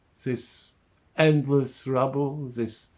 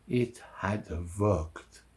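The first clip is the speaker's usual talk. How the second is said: slow, low and hushed; quiet realization; 3 semitones lower, 5 dB quieter, slower than usual. Acted. Fugitive realizing the gamble paid off, quiet and slow. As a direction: let it sink in slowly, softly. Relieved realization, first of two takes.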